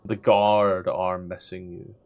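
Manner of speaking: soft, low, measured scene-setting; game master narration. Acted. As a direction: narrate a stealthy creep in a hushed, unhurried storyteller's voice.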